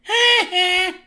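high, jeering "ha-ha"; put-on two-note taunting laugh; imitated mocking laugh. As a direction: jeer with a cartoon bully's laugh. Nelson's taunting laugh, acted.

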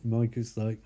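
normal talking voice

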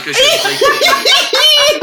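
high, slow hammed-up Jersey voice; New Jersey accent. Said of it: "give me your best New Jersey accent".

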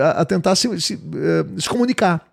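ordinary delivery

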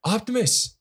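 lightly voiced Mark Wahlberg calling after his robot; mock Mark Wahlberg. Podcast host mocking Mark Wahlberg's movie line, a hint of his voice. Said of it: "Mark Wahlberg going".

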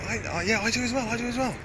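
high, hurried mock agreement; flustered man agreeing. The guy agreeing with the woman, high flustered mock voice. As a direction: imitate the guy caving in. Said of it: "the guy was saying".

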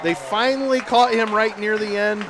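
loud touchdown call, stretched out at the score; excited play-by-play. Real, not acted.

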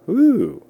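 high sarcastic whoop; a loud sarcastic woo; mock whoop. Mock cheer at negative tests.

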